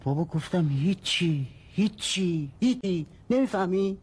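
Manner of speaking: high-pitched, exasperated outburst; exasperated yelling. Acted. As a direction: pitch climbs with each repeat, patience gone.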